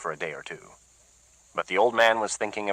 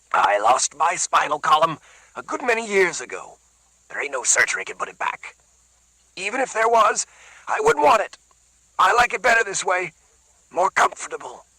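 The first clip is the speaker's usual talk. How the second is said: loose and lazy; 10 semitones higher, 3 dB louder than usual. A narrator's reading. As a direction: shrug it all off loosely. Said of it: "he said loosely and lazily".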